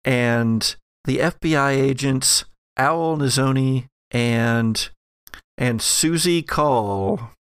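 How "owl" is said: ordinary delivery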